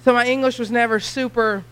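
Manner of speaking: normal talking voice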